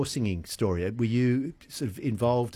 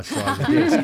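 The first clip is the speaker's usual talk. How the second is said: laughing; 8 dB louder than usual. Real, not acted. Host laughs while teasing.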